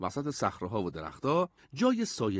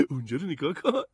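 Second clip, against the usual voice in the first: lightly voiced kid, higher pitch; amused boy; 5 semitones higher, faster than usual. A narrator's reading. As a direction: point it out with a touch of glee. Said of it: "لبخند زد و گفت".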